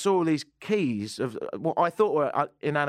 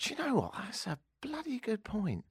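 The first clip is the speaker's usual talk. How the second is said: whispered aside, voice dropped; 6 semitones higher, 9 dB quieter than usual. Real, not acted. Conceding the listener's good point, in a whisper. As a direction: hush it as a secret.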